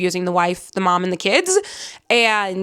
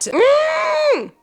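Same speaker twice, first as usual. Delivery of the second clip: swooning hum. Real, not acted. Swooning over the hero.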